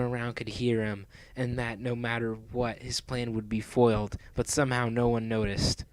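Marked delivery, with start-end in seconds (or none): none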